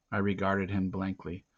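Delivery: usual speaking voice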